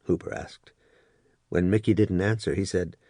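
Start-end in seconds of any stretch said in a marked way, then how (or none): none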